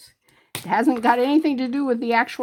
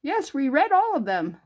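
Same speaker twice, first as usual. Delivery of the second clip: mock chirpy reassurance, higher and louder; AI channel voice; 6 semitones higher, 4 dB louder than usual. Mocking AI-narrated YouTube videos, chirpy fake voice. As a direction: parrot an AI-voiced video, sing-song. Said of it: "and they go".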